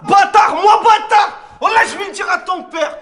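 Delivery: high furious yell at the player; outraged shout. Acted. Coach insulted by a player, screaming back in outrage. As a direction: explode at the insult, shrieking.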